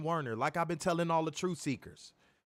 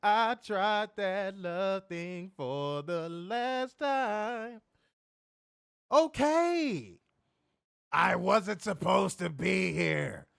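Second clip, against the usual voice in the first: slow, strained grizzled promo voice; imitating CM Punk; 3 semitones higher, slower than usual. Podcaster mocking wrestler's promo, slow grizzled voice. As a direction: drag the words out in a hammed-up rasp. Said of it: "talking in grizzled Gilbert Gottfried voice".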